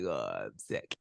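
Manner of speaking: low, groaning "Oh, I'm sick"; whiny sick husband. Retold or imitated. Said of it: "you just like".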